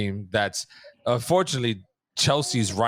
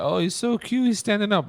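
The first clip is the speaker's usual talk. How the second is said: high, cooing lady voice; gushing bartender lady; 10 semitones higher than usual. Retold or imitated. Bartender lady coos over the baby, high and gushing. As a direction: coo like a charmed bartender. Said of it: "the bartender lady was like".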